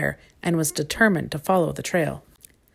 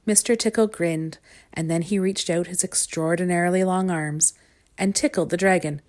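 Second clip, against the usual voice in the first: usual speaking voice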